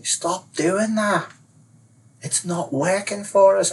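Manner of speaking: slow, high-pitched warning; insistent advisor. Acted. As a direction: spell out the order slowly, pitched high. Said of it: "the advisor's telling me".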